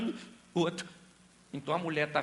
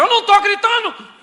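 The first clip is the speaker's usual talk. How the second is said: ironic shout; 18 dB louder than usual. Retold or imitated. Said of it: "Você diz".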